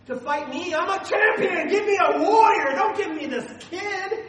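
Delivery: high, loud, laughing giant's boast; boastful giant. Retold or imitated. Preacher playing Goliath mocking David, loud and laughing. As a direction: crow over the tiny opponent with a laugh.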